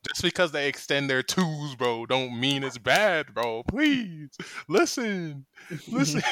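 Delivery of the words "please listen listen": mock pleading. Pleading, drawn out, high.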